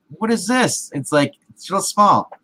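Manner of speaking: high-pitched, retold incredulous complaint; indignant disbelief. Retold complaint about the burger.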